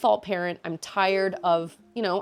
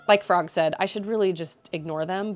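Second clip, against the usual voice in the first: ordinary talk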